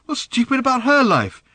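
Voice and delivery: high, loud insisting question; insistent demand. Acted. Father insisting as his daughter rages. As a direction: push back at her hard. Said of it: "insisted Arthur".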